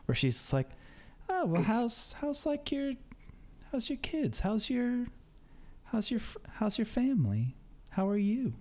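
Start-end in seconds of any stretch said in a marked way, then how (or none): 1.3-8.5 put-on high, soft, halting old lady; old woman voice, higher and softer; small-talking old woman. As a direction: make hesitant small talk as an old woman. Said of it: "the small talk old woman thing".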